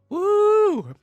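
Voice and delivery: high loud whoop; cheering. Real, not acted. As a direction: whoop for the couple. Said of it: "I don't know why I'm cheering".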